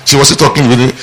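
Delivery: normal talking voice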